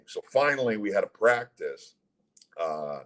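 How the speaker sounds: normal talking voice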